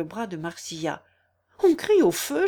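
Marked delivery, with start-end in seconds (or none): 1.6-2.5 high, louder, startled report; alarmed, pitched up. A narrator's reading. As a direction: react to a sudden fire alarm. Startled on hearing fire cries.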